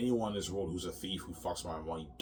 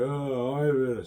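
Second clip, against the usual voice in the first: imitating wife crying; 6 semitones higher, 7 dB louder, much slower than usual. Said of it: "she's crying".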